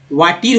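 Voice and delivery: वाटीर with a long ee in the last syllable; वाटीर, the e read as ee; wrong pronunciation demo. Shown as a demonstration. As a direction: misread water as वाटीर. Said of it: "ऐसा तो नहीं पढ़ रहे".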